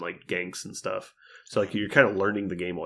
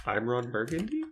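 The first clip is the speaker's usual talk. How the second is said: raised pitch climbing on "Burgundy"; rising question; 5 semitones higher, 3 dB quieter than usual. Acted. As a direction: read it as a question, lifting "Burgundy". Quotes the question-mark film gag.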